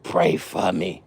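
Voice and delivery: retold hushed prayer request; slow, hushed plea. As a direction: say it low and drawn out, like a man worn down asking for help. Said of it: "He said".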